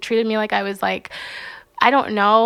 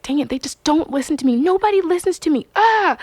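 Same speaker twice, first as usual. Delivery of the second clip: whiny frustration; 7 semitones higher than usual. Retold or imitated.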